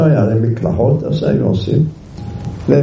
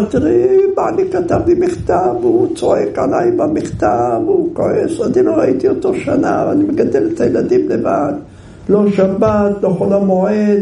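high, tearful complaint; tearful wife; 8 semitones higher than usual. Retold or imitated. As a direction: pour out her troubles near tears.

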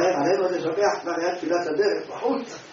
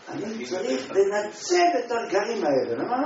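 normal talking voice

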